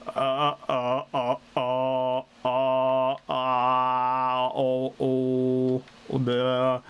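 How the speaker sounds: low, sung "uh, uh, oh"; low-pitched O. Shown as a demonstration. Streamer showing a chat tip, singing low. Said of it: "Just sing while making a low-pitched O".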